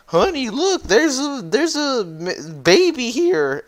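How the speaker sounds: very high, slow wifely voice; excited wife. Retold or imitated. Wife showing husband the baby, high delighted voice. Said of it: "And she's like".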